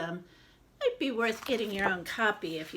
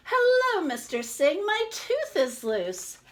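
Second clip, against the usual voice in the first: put-on excited little girl; 8 semitones higher, 5 dB louder than usual. Madlenka greets the newsagent.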